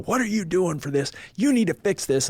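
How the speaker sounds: imitating the boss's angry yell, high-pitched; retold screaming. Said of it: "He was screaming at me".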